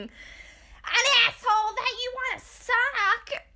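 high, drawn-out screechy imitation; hammed-up drunken-fight yell, slow and high; mock scream. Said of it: "And Jen screaming".

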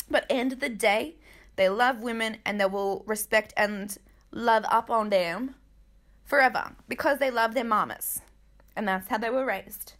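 normal talking voice

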